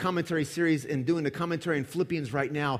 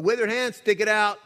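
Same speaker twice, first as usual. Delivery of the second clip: commanding call; 7 semitones higher, 6 dB louder than usual. Acted. Jesus commanding the healing.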